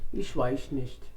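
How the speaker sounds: low, soft, drawn-out shrug of a line; German noncommittal mumble. Shown as a demonstration. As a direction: say it like a German who doesn't want to. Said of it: "कभी-कभी वो बोलते हैं".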